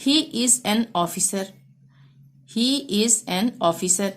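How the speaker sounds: very slow, each word spaced out; slow dictation. Shown as a demonstration. Teacher reading an example sentence to pupils, slow and clear. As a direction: read it out slowly for the class.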